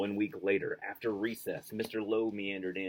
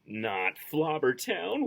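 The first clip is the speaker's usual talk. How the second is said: slow, louder, shuddering cry; shouted in dread; 6 semitones higher, 6 dB louder, much slower than usual. Acted. Children recoiling at the dreaded town name, shouting. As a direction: pull back from the name in horror. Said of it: "we shouted and shuddered".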